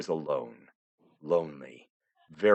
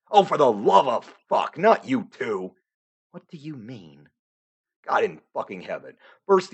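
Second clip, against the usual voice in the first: loud, higher, fast exasperated tirade; furious rant; 6 semitones higher, 6 dB louder than usual. Acted. Narrator losing it at characters who won't follow the story, furious shouting. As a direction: blow up at the characters, swearing.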